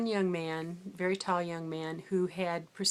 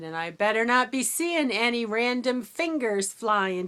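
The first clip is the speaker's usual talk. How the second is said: teacher's warning; 4 semitones higher, 8 dB louder than usual. Retold or imitated. Teacher warns boys at the back.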